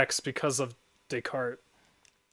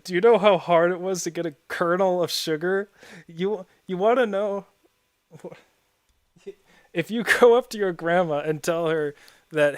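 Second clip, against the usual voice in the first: ordinary talk, nothing marked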